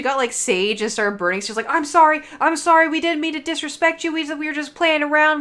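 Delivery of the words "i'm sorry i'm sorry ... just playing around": much higher, louder pleading to the spirit; retold frantic apology. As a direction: apologise to the ghost while burning sage. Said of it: "she's freaking out".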